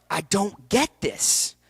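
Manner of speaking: frustrated, incredulous slow delivery; baffled. Retold or imitated. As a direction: stretch it out slowly, baffled. Acting out his bewilderment.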